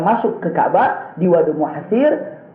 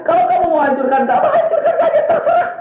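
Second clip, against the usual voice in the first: excited laughing shout; 11 semitones higher, faster than usual. Retold or imitated. Preacher shouting Abdul Muttalib's dare at Abraha, laughing. Retold defiant retort, shouted.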